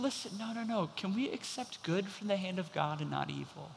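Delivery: soft, hushed and gentle; calm him down softly like a tender husband; quietly reassuring his wife. Retold or imitated. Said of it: "He's so gentle with her".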